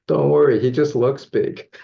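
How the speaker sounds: loud, high call from the stands; friend yelling out. Retold or imitated. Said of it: "yelling out to me".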